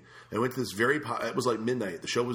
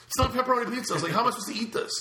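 high, indignant protest; exasperated complaint; 13 semitones higher, 3 dB louder, faster than usual. Retold or imitated. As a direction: make him see it's wrong. Complaining to waiter about pizza.

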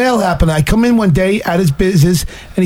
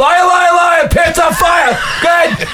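taunting accusation; 9 semitones higher, slower than usual. Real, not acted. Heckling a man whose story he disputes, high-pitched taunting. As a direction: jeer it high over everyone, like a playground chant.

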